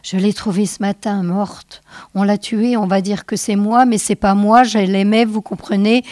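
hurried confession without a pause; half-voice, one breath. Acted. Husband confides his wife's death, hurried half-voice. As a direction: rush it out in one go. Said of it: "confia à mi-voix et d'une seule traite".

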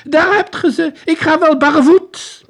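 high, loud sobbing soldier cry; hoarse cry. Acted. Soldier throwing his boots at the detective, loud high cry. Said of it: "riep hij hees".

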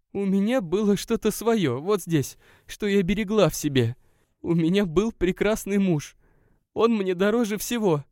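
distressed woman. A narrator's reading. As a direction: she defends what she treasured. Narrator voices the guest. (женский голос, обиженно и искренне)

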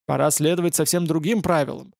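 usual speaking voice

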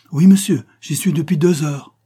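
high, loud answering call; calling back. A narrator's reading. Hidden child answers the châtelain, calling back.

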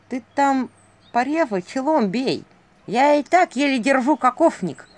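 high, strained owl voice; strangled voice. A narrator's reading. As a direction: squeeze the words out under a load. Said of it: "Послышался сдавленный голос Филина".

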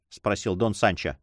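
ordinary delivery, nothing marked

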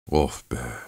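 soft, low sigh of relief; relieved. A narrator's reading. Çelkaş once the danger has passed, relieved. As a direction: let the tension out, low and quiet.

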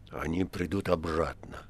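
low, soft, slow reply; quietly. A narrator's reading. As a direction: answer under the breath, slowly. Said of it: "отозвался Римский тихо". (тихо, низко и медленно)

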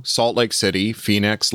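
usual speaking voice

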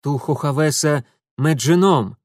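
loud forced confidence; bluffing snap. A narrator's reading. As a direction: cover doubt with bravado. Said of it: "скрывая неуверенность, бросил Митя".

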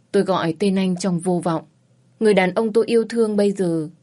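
ordinary delivery

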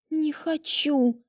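slow, drawn-out hiss; the bride hisses back at the witch; hissed refusal. A narrator's reading. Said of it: "прошипела я в ответ".

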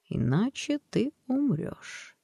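high, slow ghostly warning; eerie threat. Acted. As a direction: draw out the threat.

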